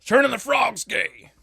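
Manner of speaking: high, loud, slow conspiracy rant; mock conspiracy theorist; conspiracy ranter. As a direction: ham up a tinfoil hat nut.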